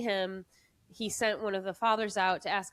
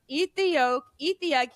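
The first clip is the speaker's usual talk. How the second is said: playful command; 6 semitones higher, 6 dB louder than usual. Retold or imitated. Father commanding, retold.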